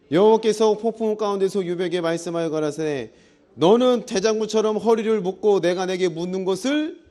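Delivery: loud, declamatory Bible reading; scripture reading. Acted. Pastor reads God speaking out of the storm, scripture reading. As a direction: proclaim the verse with force.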